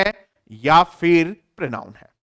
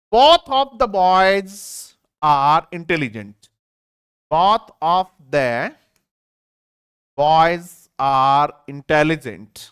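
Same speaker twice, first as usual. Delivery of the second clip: very slow, phrase by phrase with pauses; slow reading aloud; much slower than usual. Shown as a demonstration. Teacher dictating an example sentence, very slow. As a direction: read it out slowly for students to follow. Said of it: "दोनों के दोनों बच्चे".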